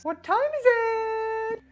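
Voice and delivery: high, drawn-out holler; silly high show-host question; hyped-up call. Pretend. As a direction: call it out way up high, stretching the end. Game-show style call before lucky dip.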